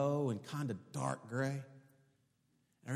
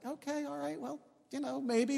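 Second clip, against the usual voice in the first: doubtful humouring; 13 semitones higher than usual. Retold or imitated. Retold doubtful husband humouring wife.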